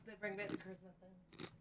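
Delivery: normal talking voice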